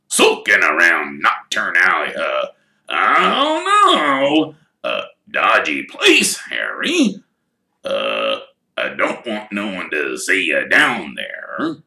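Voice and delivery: big rough half-giant, gruff and chiding; Hagrid's voice. Acted. Hagrid scolding Harry for Knockturn Alley, in a gruff giant voice. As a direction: scold Harry like a worried gamekeeper.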